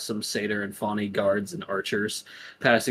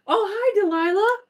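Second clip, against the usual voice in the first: very high, sweet fake-friendly hello; sugary greeting. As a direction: greet her with syrupy sweetness. Sister greets Delilah, sweetly.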